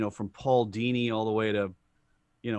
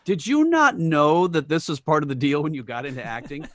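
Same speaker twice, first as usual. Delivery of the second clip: loud, exasperated rhetorical question; mock scolding; 3 semitones higher, 6 dB louder than usual. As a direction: scold them in mock disbelief, loudly. Mock scolding of complaining actors.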